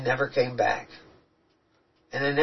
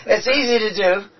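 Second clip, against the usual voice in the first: higher, louder, laughing reassurance; laughing agreement; 6 semitones higher, 5 dB louder than usual. Real, not acted. Reassuring the person who admitted they broke, laughing. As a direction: agree through real laughter.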